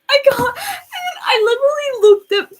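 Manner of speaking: high, crying shout; loud tearful wail. Retold or imitated. Girl retelling her breakdown facing Philip, loud high wail. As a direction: cry it out loud and squeaky.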